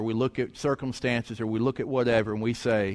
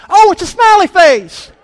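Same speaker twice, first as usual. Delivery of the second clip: very high, loud squeal of discovery; excited mother; 15 dB louder than usual. Retold or imitated. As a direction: imitate Mom bursting out as she finally gets it. Mother jumps up, gets it.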